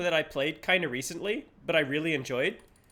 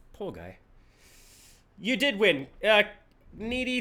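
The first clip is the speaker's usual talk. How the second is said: ordinary talk, nothing marked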